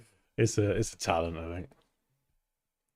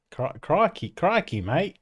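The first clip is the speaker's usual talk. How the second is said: higher, badly faked Australian accent; Australian accent attempt; 5 semitones higher than usual. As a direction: have a halting go at Australian. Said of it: "I can't do Australian".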